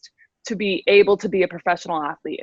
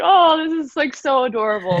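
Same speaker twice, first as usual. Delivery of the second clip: high, gushing over a dress; playful high-pitched gush; mock gushing; 7 semitones higher than usual. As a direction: gush like a teen at prom.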